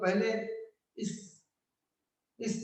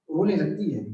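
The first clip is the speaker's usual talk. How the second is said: ordinary delivery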